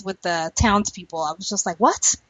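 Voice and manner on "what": ordinary talk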